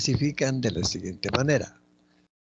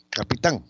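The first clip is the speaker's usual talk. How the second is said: "capitán" with a firm stress on TÁN; teacher's clear demonstration of "capitán", stressed at the end; stress on last syllable; 4 dB louder than usual. Shown as a demonstration. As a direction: land on the final syllable of "capitán". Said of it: "la sílaba tónica es tan".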